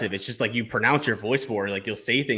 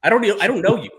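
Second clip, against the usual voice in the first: high, loud dismissive snap at a stranger; mock outburst; 10 semitones higher, 6 dB louder, faster than usual. Coach scoffing at a fake-profile stalker, high and loud. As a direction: brush him off loudly.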